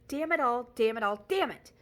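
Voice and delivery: rising furious cursing; angry outburst. Acted. Montag erupting at the women.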